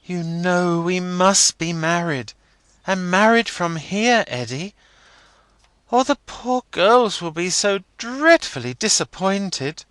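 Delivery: put-on high girlish outburst; quaintly bursts out. A narrator's reading. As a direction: blurt it out naively. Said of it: "quaintly bursts out with".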